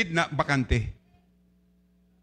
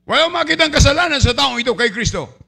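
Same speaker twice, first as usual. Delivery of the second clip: higher, louder, fast governor's proclamation; Pilate declaring to the crowd; 7 semitones higher, 6 dB louder than usual. Acted. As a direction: call out to the people as Pilate. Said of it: "ang sabi ni, ah, Gobernador Pilato".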